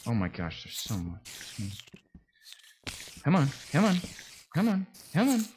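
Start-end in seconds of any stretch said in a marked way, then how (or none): 3.2-5.4 high, loud repeated urging; urgent urging. Real, not acted. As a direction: push them on impatiently. Urging on in game.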